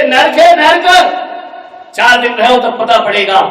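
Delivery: loud, high indignant outburst; angry bride venting to father; outraged cry. Acted. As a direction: shout that it's hell. Daughter calls in-laws hell.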